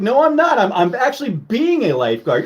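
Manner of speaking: high mock protest easing down; protest, then settle back; mock rescuer. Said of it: "I'm like".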